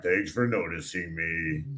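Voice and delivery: put-on low, soft, slow girl voice; a shy, quiet thank-you; imitating the girl, low and hushed; mimicking her. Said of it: "she was sitting there like".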